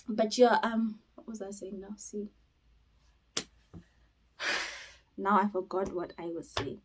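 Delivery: ordinary talk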